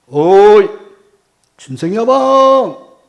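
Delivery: loud, long-drawn hail; leisurely call. Retold or imitated. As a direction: call out unhurriedly from afar, stretching the name. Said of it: "아주 느긋하게". (느긋하게 길게 뽑아 크게 부르기)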